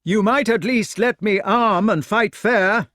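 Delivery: high, loud plea for fairness. A narrator's reading. Unarmed knight to his attacker below, protesting loudly. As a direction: call the protest down from a height.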